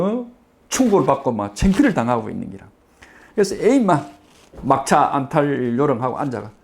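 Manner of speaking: ordinary talk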